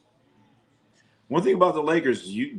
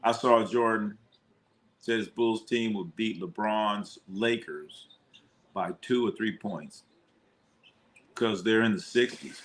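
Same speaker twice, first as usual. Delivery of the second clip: ordinary talk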